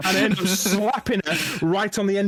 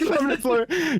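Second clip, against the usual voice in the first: high, giggling echo of the phrase; laughing repeat; 10 semitones higher than usual. Real, not acted. Echoing his mate's joke, squealing with laughter.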